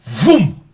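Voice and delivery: shouted engine vroom; loud vroom. Pretend. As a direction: belt out a vroom like a revving engine. Kicking trolls out of chat.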